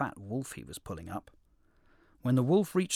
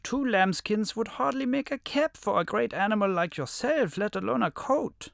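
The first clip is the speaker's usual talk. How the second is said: wolf voice; 8 semitones higher, 5 dB louder than usual. A narrator's reading. Wolf tricks the lion.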